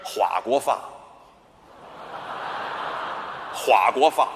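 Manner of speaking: 法国话 with hua and fa swapped, huaguofa; garbled pronunciation. Acted. Comic boasting of his French, garbles 法国话 as huaguofa. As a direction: say 法国话 low, first and last syllables switched. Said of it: "你想说法国话吧".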